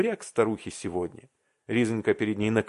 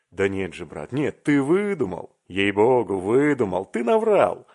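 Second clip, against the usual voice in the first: shouting in disbelief; 6 dB louder than usual. A narrator's reading. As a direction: yell at him that he made it up. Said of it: "закричал Аркадий Иванович и даже с неподдельным сомнением".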